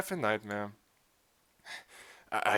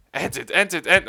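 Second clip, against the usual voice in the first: frantic shout; 8 semitones higher, 11 dB louder than usual. Acted. Outburst before being hit.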